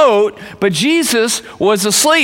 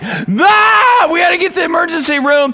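panicked shout; 9 semitones higher than usual. Retold or imitated. Retelling rushing his bleeding daughter to the ER, panicked rushed shout. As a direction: shout it in a rush like your kid is bleeding.

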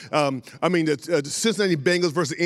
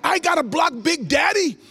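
panicked high cry; 10 semitones higher than usual. Retold or imitated. Player panicking about blocking Big Daddy, high yelp. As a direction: yelp it like a scared kid. Scared realization in locker room.

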